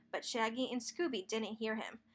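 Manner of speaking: plain reading voice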